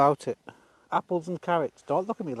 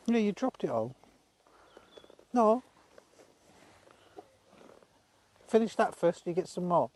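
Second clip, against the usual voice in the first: much higher, gently reproachful pet voice; fond pet talk; 5 semitones higher than usual. Real, not acted. Telling the horse to finish its food, high fond chiding.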